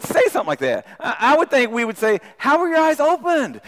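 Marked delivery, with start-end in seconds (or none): 2.4-3.6 high and excited, amazed; excited curiosity. Acted. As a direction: ask it full of delighted wonder. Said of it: "with excitement or with curiosity".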